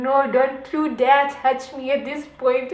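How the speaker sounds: loud refusal; loud protest. Retold or imitated. Warding off the touch.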